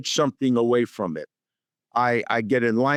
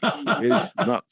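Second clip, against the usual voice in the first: slow, laughing through it; 8 semitones higher, slower than usual. Real, not acted. Telling the host about Jim Carrey, laughing. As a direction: chuckle it out.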